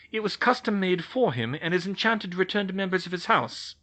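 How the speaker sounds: firm older witch, lightly voiced; scolding the ambassador, lightly played; McGonagall. McGonagall explains the sword.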